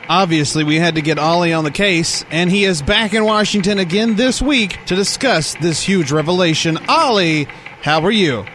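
bright news anchor intro. Acted. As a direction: present it like a TV anchor. Hosts the news segment.

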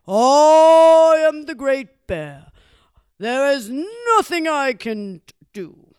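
boastful Great Bear. Acted. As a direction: bellow it slowly like a self-important bear.

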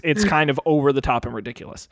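ordinary delivery

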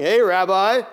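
mocking greeting. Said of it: "making a mockery of the whole thing".

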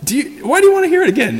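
high-pitched sarcastic I-already-told-you; mocking. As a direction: taunt them like they never listen. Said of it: "He's mocking them".

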